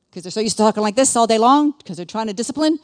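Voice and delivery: loud, frustrated mom imitation; disciplining mom. Shown as a demonstration. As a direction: hammer it out as if scolding children. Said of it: "talk like this".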